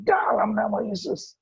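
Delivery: high-pitched mock yell, no real volume; retold shout. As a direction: imitate crying out a rebuke in prayer. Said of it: "Saya bilang, saya teriak-teriak".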